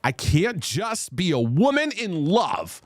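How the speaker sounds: dramatic reading. Pretend. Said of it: "I'm adding drama to it".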